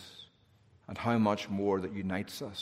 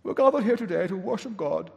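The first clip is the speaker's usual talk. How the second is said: high, grand preacher voice; pompous pulpit voice; 9 semitones higher than usual. Retold or imitated. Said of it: "preaching with one of those pompous voices".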